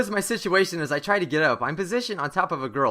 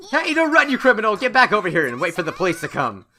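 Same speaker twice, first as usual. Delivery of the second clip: angry shout; 3 semitones higher, 6 dB louder than usual. Acted. Girl yells after fleeing suspect, angry shout. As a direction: order the runaway back, pitched up.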